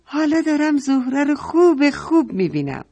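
put-on a small girl thrilled at seeing her friend; high child voice full of delight. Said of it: "مریم گفت".

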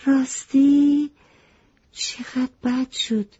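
drawn-out storybook surprise at the bad news; surprised. A narrator's reading. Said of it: "خیلی تعجب کرده بود گفت".

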